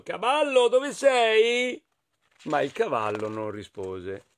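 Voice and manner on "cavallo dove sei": high, long-voweled searching call. Acted. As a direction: call out for a missing horse. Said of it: "esclamò Riccio". (richiamo acuto nella nebbia)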